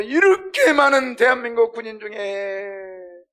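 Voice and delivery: bereaved mother's drawn-out howl; high, slow wail building to a scream; anguished wailing. Acted. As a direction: cry out against fate in grief. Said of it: "하면서 막 울부짖는데요".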